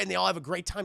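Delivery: ordinary talk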